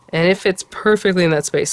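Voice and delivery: usual speaking voice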